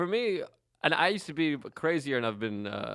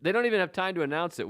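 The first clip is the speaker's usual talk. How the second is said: ordinary delivery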